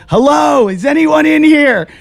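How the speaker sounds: high, loud call into an empty room; calling out, locked in alone; retold yelling. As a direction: shout hello, starting to panic. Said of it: "I'm yelling and screaming".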